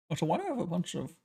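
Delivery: talking into a cup; words around a sip of tea; while sipping a drink. Real, not acted. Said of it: "I'm just drinking my tea".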